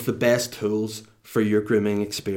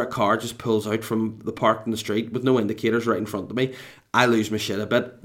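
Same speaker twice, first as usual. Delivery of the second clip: ordinary delivery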